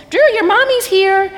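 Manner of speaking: put-on high, loud child calling out; little girl calling. Girl tells Drew his mommy is here, high and loud. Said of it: "and she goes".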